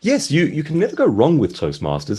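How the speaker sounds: normal talking voice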